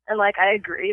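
ordinary talk, nothing marked

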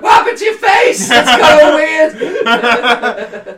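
high shocked yelling; shocked shout. Pretend. Girlfriend reacting, acted quote.

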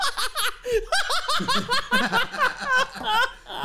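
long high giggling fit; can't stop laughing at the joke; helpless laughter. Real, not acted. Laughing fit while cohost tries to talk. Losing it over a bit.

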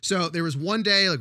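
ordinary talk

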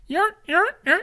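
high-pitched spinning-wheel noise; sound effect. Pretend. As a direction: mimic the wheel clicking. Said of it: "making sound effects".

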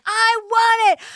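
high, slow whiny tantrum scream; child's tantrum yell. Retold or imitated. Little girl banging her head at the window, tantrum wail. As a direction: wail it like a bratty seven-year-old. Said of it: "Bang my head ... be like".

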